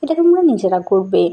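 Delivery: ordinary delivery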